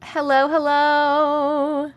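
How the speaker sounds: high, slow, held-out hello; lilting welcome to arrivals; singsong greeting. Real, not acted. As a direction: stretch the last vowel on a high note. Greeting viewers joining the stream.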